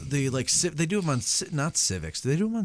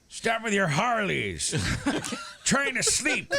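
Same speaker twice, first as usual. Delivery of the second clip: put-on grumpy old man yelling out the window; shouting at trespasser; 6 semitones higher, slower than usual. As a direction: scold them like a cranky neighbor. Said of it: "Get off my lawn".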